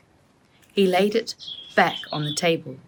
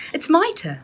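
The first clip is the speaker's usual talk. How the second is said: eager claim; 12 semitones higher than usual. A narrator's reading. Rose eagerly claims her turn.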